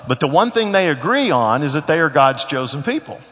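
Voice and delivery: ordinary delivery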